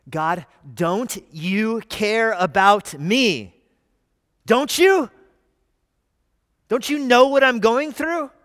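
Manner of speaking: loud, high pleading cry; anguished plea. Retold or imitated. Believer in a trial crying to God, loud anguished plea. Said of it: "Your heart right away goes to".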